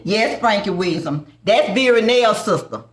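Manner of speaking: usual speaking voice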